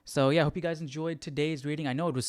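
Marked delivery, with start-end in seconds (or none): none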